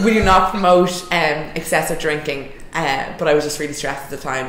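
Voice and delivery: ordinary talk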